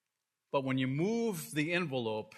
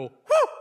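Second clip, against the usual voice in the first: high, loud whoop of joy; joyful whoop; 11 dB louder than usual. Retold or imitated. Preacher imitating John cheering over Jesus, loud whoop. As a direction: let out a whoop like John overwhelmed with praise. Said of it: "You can almost hear John go".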